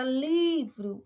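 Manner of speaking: LI of "livro" loud and stretched; stressed first syllable. Shown as a demonstration. Teacher showing the class the tonic syllable, LI in "livro" stressed. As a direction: hit and hold the LI in "livro". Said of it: "o li é a sílaba tônica".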